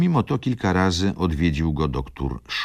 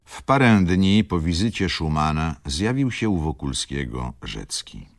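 ordinary delivery

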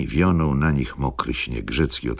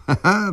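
high, short ha-ha; acted laugh; 4 dB louder than usual. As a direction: toss in a high two-beat laugh. (wysoki, udawany śmiech)

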